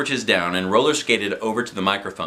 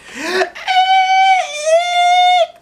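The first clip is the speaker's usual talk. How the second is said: very high, loud shrieking scream; screaming fangirl shriek; crowd scream; 7 dB louder than usual. Acted. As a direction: shriek like fans seeing a star. Girls scream at star.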